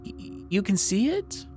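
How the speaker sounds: high, stammering, frightened whisper. Acted. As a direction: ask it as if scared to hear the answer. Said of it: "she went pale".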